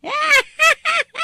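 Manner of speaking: hearty, high-pitched burst of laughter; a big, delighted cackle; loud laugh. Acted. Mother laughing loudly at news.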